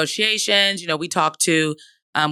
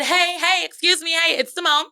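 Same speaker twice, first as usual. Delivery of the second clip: retold yelling; 10 semitones higher than usual. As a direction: call for attention from off to the side. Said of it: "so now I'm yelling".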